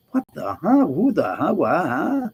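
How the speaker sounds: swooping up and down, baffled; exaggerated confused stammer, pitch seesawing high; mock bafflement. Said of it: "it's literally, it's like".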